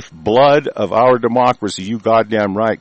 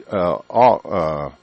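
normal talking voice

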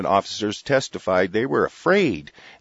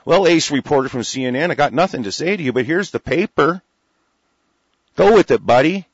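louder, rising defiance ending in a higher shout; mock defiant retort; 4 semitones higher, 5 dB louder than usual. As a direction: brush off the reporter with swagger. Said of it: "What are you guys up here for".

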